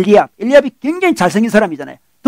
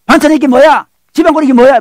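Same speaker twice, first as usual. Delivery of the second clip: loud, raised-pitch mock griping; acted irritation; 5 semitones higher, 6 dB louder than usual. Husband takes work stress out on his wife, mock angry outburst. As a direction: imitate a husband lashing out at home. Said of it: "짜증내고 화내고 막 그러잖아요".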